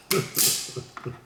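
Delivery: short burst of laughter; real laugh. Real, not acted. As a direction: chuckle hard at your own joke. Laughs at own joke.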